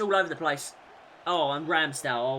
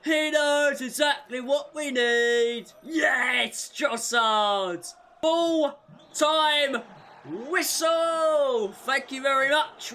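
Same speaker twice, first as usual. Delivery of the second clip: high, loud elated celebration; jubilant; 9 semitones higher, 5 dB louder, slower than usual. Real, not acted. Gamer celebrating the trophy win, elated. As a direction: gloat happily at the final whistle.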